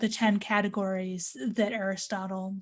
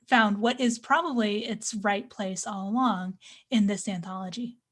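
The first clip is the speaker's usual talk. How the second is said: ordinary talk, nothing marked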